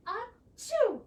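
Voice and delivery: high loud fake sneeze; big sneeze. Rabbit sneezes into hand.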